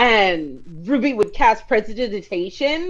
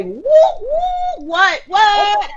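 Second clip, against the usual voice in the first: excited whooping; 9 semitones higher, 3 dB louder, much slower than usual. Acted. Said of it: "Orchid and Ruby are like".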